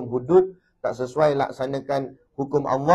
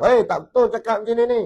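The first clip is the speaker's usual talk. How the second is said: mock objection; 9 semitones higher, 5 dB louder than usual. Mocking a stubborn objector.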